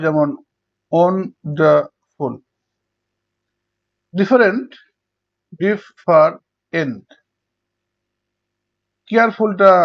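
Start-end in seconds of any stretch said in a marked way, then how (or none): 0.9-2.3 wonderful slowly in three beats; syllable by syllable. Shown as a demonstration. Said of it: "এটাকে তিনবার উচ্চারণ করা হয়". 5.6-7.1 different slowly in three separate beats; syllable by syllable. Shown as a demonstration. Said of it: "তিনবার উচ্চারণ করা হয়".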